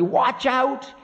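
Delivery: high, sharp warning; indignant high voice of Time; sharp anger. Acted. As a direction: snap a high-pitched threat. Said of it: "said Time sharply".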